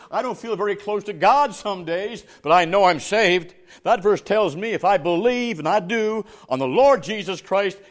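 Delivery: loud, emphatic pulpit testimony; full-force preaching. Real, not acted. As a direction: hammer the certainty home to the congregation.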